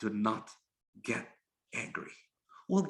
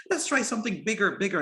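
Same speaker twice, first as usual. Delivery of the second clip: higher, eager mock-drunk voice; drunken revellers; 7 semitones higher than usual. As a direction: egg on your drinking pals. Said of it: "they're drunk and just throwing it".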